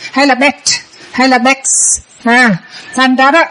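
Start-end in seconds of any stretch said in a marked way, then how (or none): 0.3-0.5 "bet" with a released T at the end; sounded final T. Shown as a demonstration. Teacher contrasting endings, "bet" with an audible final T. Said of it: "âm cuối rất là quan trọng". 1.4-1.7 "backs" ending in an audible K and hiss of S; sounded final KS. Shown as a demonstration. Teacher contrasting endings, "backs" with both final consonants sounded. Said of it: "âm cuối rất là quan trọng".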